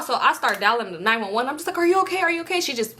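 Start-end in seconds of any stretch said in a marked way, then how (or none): 1.8-2.6 high-pitched, repeated fretting; acted panicky checking on someone; frantic worry. Driver to the squatting pregnant woman, frantic. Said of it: "I was going crazy".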